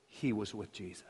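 very low, hushed pointed statement; hushed accusation. Acted. Servant girl accusing Peter by the fire, hushed. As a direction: drop almost to nothing as you accuse.

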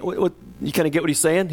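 normal talking voice

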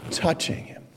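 voiceless whisper after a long pause; hushed awe. Acted. Preacher narrating Jesus touching the leper, hushed whisper. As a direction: drop to breath alone. Climax of the slow-motion touch.

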